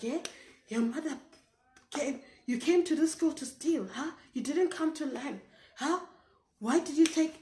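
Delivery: loud, high-pitched imitated interrogation; mock scolding. Teacher accusing pupil while beating her, mock angry scolding.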